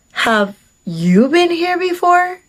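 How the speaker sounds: slow, high mimicking of a question; retold nosy question. Date quizzing her at a restaurant, mimicked nosy question. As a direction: draw it out, pitch climbing on "you". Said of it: "the same crazy question".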